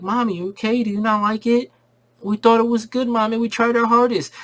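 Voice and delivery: put-on worried little boys, a bit up in pitch; kid voices. Kids asking their crying mom, mildly kid-voiced.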